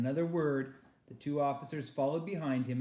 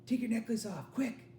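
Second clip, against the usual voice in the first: put-on urgent girl; 9 semitones higher than usual. Girl urges friend.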